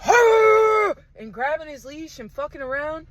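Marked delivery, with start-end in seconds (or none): 0.1-0.9 loud high mock dog bark; dog growl. Voicing her dog lunging on the leash, loud mock growl. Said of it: "my dog's like".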